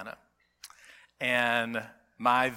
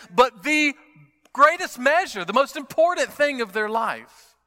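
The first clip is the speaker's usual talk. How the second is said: impassioned preaching; 7 semitones higher, 7 dB louder than usual. Real, not acted. Preacher on the true measure of a person, impassioned preaching. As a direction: preach it high and strong, leaning on the key words.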